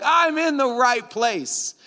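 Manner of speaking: high, drawn-out elation; mock triumph. Lost rider spotting the water tower, slow high triumph. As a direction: stretch it out in soaring relief. Triumphant acted reaction to landmark.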